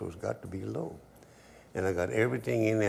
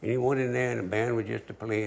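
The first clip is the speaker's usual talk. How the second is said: usual speaking voice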